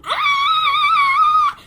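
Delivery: very high excited shriek; overjoyed squeal at making weight; joyful scream. Retold or imitated. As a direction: let out a thrilled high scream. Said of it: "And I was like".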